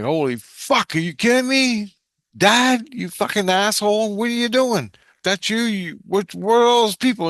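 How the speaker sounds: high, loud, incredulous outburst; freaking out. Retold or imitated. Son confronting his dead dad in a dream, freaking out. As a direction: retell it as a stunned, high-pitched protest. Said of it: "I was freaking out".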